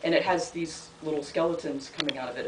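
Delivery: usual speaking voice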